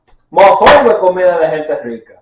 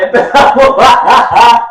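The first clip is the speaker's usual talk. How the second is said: slow, laughing, a bit louder; laughing through words; 5 semitones higher, 7 dB louder, much slower than usual. Real, not acted. Laughing at a bad start with his co-host. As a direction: laugh it out as you repeat yourself.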